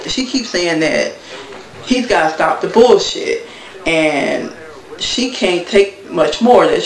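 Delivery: ordinary talk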